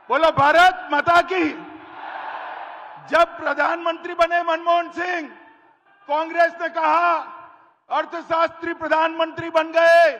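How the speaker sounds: loud fiery campaign oratory; hindi political rally speech. Acted. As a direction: rouse the crowd from the stage.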